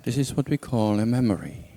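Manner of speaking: calm, unhurried meditation guidance with long pauses; guided meditation. Real, not acted. Teacher guides listeners back through a memory, calm meditation voice. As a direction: lead them inward gently, leaving silence between phrases.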